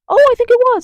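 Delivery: very high, loud sarcastic squeal; mock realization shot way up in pitch. Real, not acted. Answering your own question about Trump, sarcastic.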